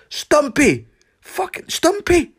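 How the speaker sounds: high, loud shout to wake his friend; yelling his name. Retold or imitated. Waking his snoring mate Stumpy with a loud, high shout. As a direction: bellow Stumpy awake across the room.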